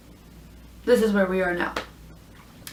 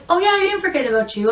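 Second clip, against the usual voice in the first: breezy driver's excuse; 9 semitones higher, faster than usual. Retold or imitated.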